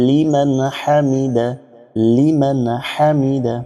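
লিমান হামিদা drawn out; slow recitation. Shown as a demonstration. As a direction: recite slowly with a clear ha. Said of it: "এই হা পেশ হু বলে যদি থামেন". (ধীরে স্পষ্ট হা দিয়ে)

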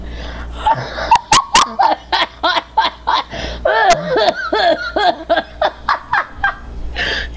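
long, high-pitched laughing fit; real uncontrollable cackling fit; helpless laughter. Real, not acted. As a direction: laugh until you're done for. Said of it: "I'm so fucking done".

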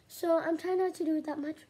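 ordinary delivery

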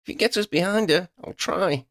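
high, urgent call back; mildly shouted, high-pitched offer; shout over shoulder. A narrator's reading. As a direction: call your plan back to the flier. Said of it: "Lydia shouted over her shoulder".

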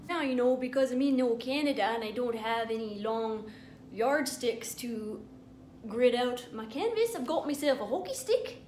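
a lightly hammed-up Canadian accent; mock Canadian accent. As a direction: play a jokey Canadian accent. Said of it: "apparently that's Canadian, eh?".

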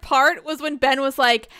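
ordinary delivery

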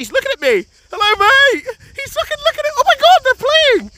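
very high thrilled squeals; excited squealing. Real, not acted. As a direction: squeal with delight. Thrilled seeing seals up close.

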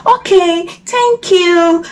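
high little frog voice; high, sing-song thanks; relieved middle frog. A narrator's reading. Middle frog thanks crocodile, released.

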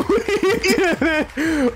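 high, slow squealing cheer; excited squeal. Real, not acted. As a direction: shriek with delight, laughing. Winning the rigged contest.

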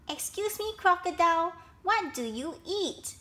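high, courteous little voice; polite baby elephant. A narrator's reading. Baby elephant to crocodile, asking politely. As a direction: ask sweetly and politely, pitched high. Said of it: "elephant asked politely".

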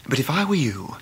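soft, confidential warning whisper. Acted. A young man quietly warns his friend about lending money, whispering. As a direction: warn him low so no one hears.

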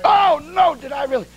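hammed-up high gasp of wonder; mock shock. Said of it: "looking up there in shock and say".

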